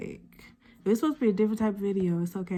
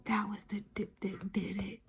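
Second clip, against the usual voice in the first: ordinary talk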